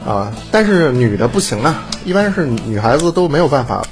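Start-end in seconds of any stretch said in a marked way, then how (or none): none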